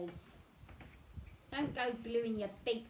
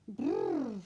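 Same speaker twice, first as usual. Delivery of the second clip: shivering brrr; 3 semitones higher, 8 dB louder than usual. Acted. Penguin shivering.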